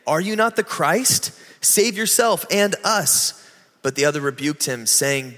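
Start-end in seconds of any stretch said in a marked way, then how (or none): 0.1-3.3 railing. A narrator's reading. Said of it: "railed at him".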